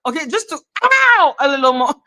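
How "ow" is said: sudden high-pitched "ow"; high yelp. Shown as a demonstration. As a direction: yelp it out, much higher. Repeats the demonstrated noise.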